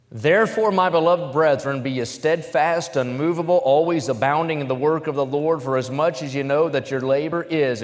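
fervent proclamation of the verse; preaching scripture. Acted. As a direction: exhort the brethren with the verse. Reads scripture at full force.

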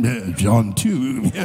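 low, slow, drawn-out John too; drawn-out preacher intoning. Retold or imitated. [name] thinking of his favorite verse, slow low intoning. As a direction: stretch it out like a solemn sermon line. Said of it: "I'm thinking".

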